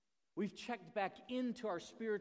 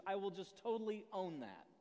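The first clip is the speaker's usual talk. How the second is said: ordinary talk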